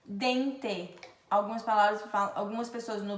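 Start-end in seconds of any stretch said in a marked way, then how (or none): 0.0-1.0 "dente" ending in plain "teh"; regional hard T. Shown as a demonstration. Teacher showing other regions' way, "dente" with hard t. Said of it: "vão falar dente".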